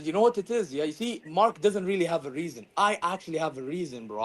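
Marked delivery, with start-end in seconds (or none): none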